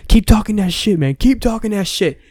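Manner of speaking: high-pitched, taunting retort. Retold or imitated. Comic taunting the angry meathead, high defiant taunt. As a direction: egg him on, mocking and defiant.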